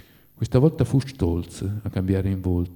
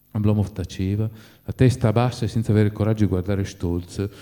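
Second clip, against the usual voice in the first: ordinary delivery, nothing marked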